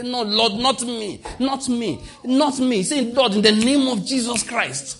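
high, loud pleading refusal; fervent prayer cry. Shown as a demonstration. Preacher leading the congregation in prayer, high and loud.

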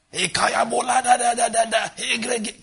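high, babbling mimic of a child praying; mocking child's prayer. Preacher mocking children who pray for dollars, high mimic. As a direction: squeak it out like a kid begging God.

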